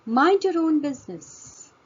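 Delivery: high, loud mock scold leaning on own; teacher acting out a mild rebuke; emphatic scolding demo. Shown as a demonstration. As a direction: snap own at a nosy listener. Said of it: "emphasis to something".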